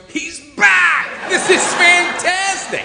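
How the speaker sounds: high, loud, thrilled dog shouting; excited dog voice. Retold or imitated. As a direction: play the overjoyed dog greeting its owner. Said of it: "Lulu looks up and goes".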